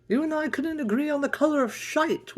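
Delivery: high, loud scolding outburst; shrill angry Kendall. Acted. Kendall scolding him over the breakfast supplies, shrill and angry. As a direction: yell at him for using up supplies. Corrected repeat.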